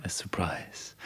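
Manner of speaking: whispering. Pretend. Teasing a secret surprise.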